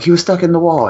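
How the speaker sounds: high-pitched mock exasperation; retold frantic insistence. Husband explains the stuck dog to his wife, high mock-frantic. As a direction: insist on it in a heightened voice.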